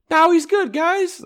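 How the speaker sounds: high mocking sing-song; squeaky mock greeting voice. Mocking Avo's fake friendliness.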